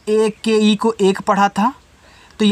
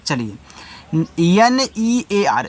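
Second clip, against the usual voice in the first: normal talking voice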